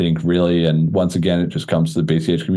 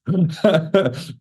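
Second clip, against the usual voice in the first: high laugh; 10 semitones higher than usual. Real, not acted. Laughs at chat quote.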